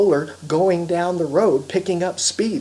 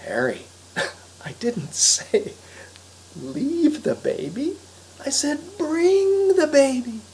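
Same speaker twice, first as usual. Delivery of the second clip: high, drawn-out exasperated scolding; exasperated correction; 11 semitones higher, slower than usual. Acted. Wife to Harry about the baby, exasperated correction. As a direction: slowly spell it out, pitch climbing in exasperation.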